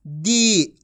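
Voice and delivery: दी with a long, sustained ee; drawn-out long ee. Shown as a demonstration. Teacher models the syllable for learners, vowel drawn out. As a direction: hold the ee in दी. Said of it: "खींच खींच के पढ़ेंगे".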